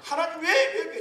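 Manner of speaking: high, insistent repeated WHY; blunt protest. Shown as a demonstration. Pastor demonstrating crude complaining to God, high and insistent. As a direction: protest at God, pitch jumping up on each why. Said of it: "이러지 말고".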